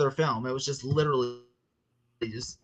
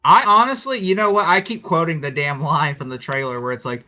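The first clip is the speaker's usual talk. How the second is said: normal talking voice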